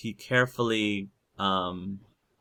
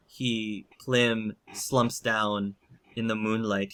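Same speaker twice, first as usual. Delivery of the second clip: ordinary talk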